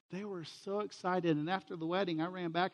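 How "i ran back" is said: ordinary talk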